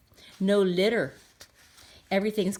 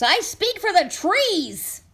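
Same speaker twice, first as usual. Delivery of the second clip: higher, loud and bossy little creature; sharpish, bossy Lorax; 10 semitones higher, 5 dB louder, slower than usual. Acted. Lorax confronts the Once-ler, bossy sharp scolding. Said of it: "I repeat".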